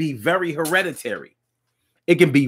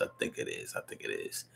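whispered aside; 21 dB quieter than usual. Real, not acted. Host muttering his doubt to himself, whispering. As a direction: drop to a breathy whisper, almost unvoiced.

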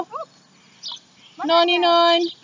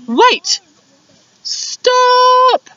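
alarmed shout; 5 dB louder than usual. Acted. Animals stop Dingo.

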